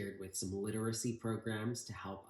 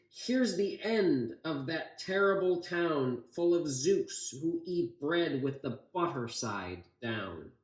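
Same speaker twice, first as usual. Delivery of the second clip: higher, louder storybook scream, lightly done; 6 semitones higher, 6 dB louder, slower than usual. Acted. Grandpa screaming from the wall at the enemy town, mock scream. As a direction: hurl the battle cry from the wall. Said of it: "He screamed".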